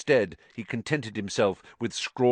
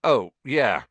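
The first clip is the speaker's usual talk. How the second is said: louder, higher, unconvincing agreement; failed attempt at pleased; 4 semitones higher, 6 dB louder than usual. A narrator's reading. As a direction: try to sound pleased and not manage it. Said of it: "trying and failing to look pleased".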